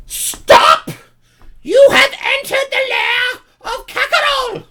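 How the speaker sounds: loud, very high kobold proclamation; Kakarol. Acted. Regal kobold leader challenging intruders, high-pitched shout. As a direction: shout it as a self-important little dragon lord in a piercingly high voice. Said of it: "he stands up and he goes".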